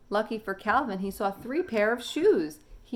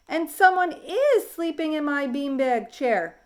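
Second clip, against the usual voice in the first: higher, louder, slow squeak; squeaky baby; 9 semitones higher, 7 dB louder than usual. Acted. Baby finding Calvin asleep in his chair, squeaking. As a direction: squeak it like a tiny excited child. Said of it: "squeaked the baby".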